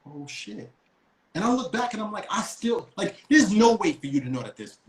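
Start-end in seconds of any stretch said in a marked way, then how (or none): 0.1-0.7 soft dismayed under the breath; a quiet sinking realization; hushed oh shit. Retold or imitated. Driver realizing police surround the car, hushed.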